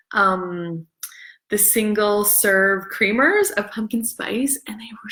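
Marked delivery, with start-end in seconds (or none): 4.7-5.1 breathy little whisper of delight. Real, not acted. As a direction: whisper it like a confession. Raving about the creamers.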